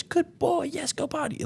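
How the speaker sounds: very high, gushing praise, then dropping soft. Shown as a demonstration. Trainer showing the praise to skip at night, gushing. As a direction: cheer the dog on like a proud owner. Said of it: "giving, like, high praise".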